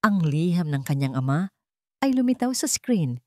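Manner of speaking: ordinary delivery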